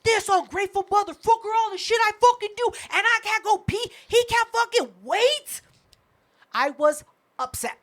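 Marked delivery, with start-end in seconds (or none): 0.0-5.6 higher-pitched, hyped-up inner tirade; indignant retold venting; retold angry rant. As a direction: replay your anger building in your head. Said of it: "My anger was hyping me up".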